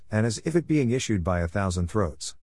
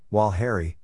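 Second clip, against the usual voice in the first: ordinary delivery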